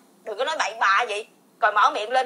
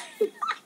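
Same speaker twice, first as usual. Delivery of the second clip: soft, very high giggle; very high breathy giggle; 8 dB quieter than usual. Real, not acted. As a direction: let a tiny high giggle slip out. Laughs at husband's question.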